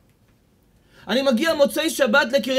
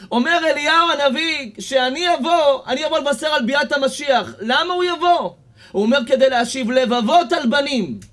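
raised, higher sermon voice; preaching; 4 semitones higher, 3 dB louder than usual. Real, not acted. Rabbi preaching to congregation about parents, fervent. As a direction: preach it to the hall.

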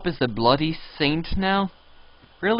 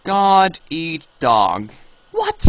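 ordinary talk

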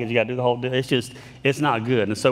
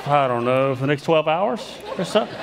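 chuckling through an embarrassed reply; laughing sheepishly. Real, not acted. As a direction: laugh it off while you admit defeat. Sheepish reply to gate agent.

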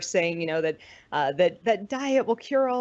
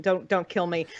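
normal talking voice

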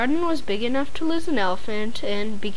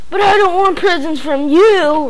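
loud, higher bratty roar; 6 semitones higher, 7 dB louder than usual. A narrator's reading. Angry boy refusing his parents' gifts, roaring. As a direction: bellow it like a spoiled child. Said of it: "roared Bradley".

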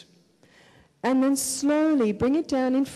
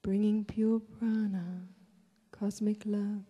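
guided meditation; 4 dB quieter than usual. Real, not acted. Leads a breathing meditation.

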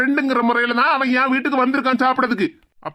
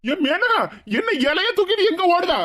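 higher-pitched startled question; alarmed call; 5 semitones higher than usual. Acted. Friend calling after the runaway with the food, alarmed. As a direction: call after someone running off, voice raised in pitch.

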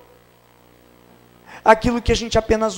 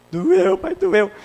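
put-on child crying out; 12 semitones higher, much slower than usual. Daughter answering while being spanked.

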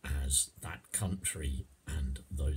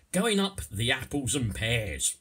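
raised, louder Cockney voice; Cockney accent; 5 semitones higher, 5 dB louder than usual. Shown as a demonstration. Teacher giving a slang example, in Cockney. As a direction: sing out the phrase as a Cockney. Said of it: "I'll do the first bit in Cockney".

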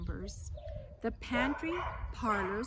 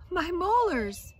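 joyful yell, much higher and louder; 9 semitones higher, 11 dB louder, slower than usual. Acted. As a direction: yell as the molars turn up. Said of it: "leaped up and yelled".

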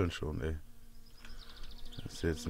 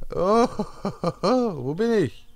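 surprised; 11 semitones higher, 7 dB louder than usual. Pretend. Wakes up confused after blackout.

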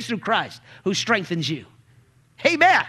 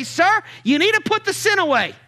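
mock rebuke; 7 semitones higher than usual. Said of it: "if I walk up to you and say".

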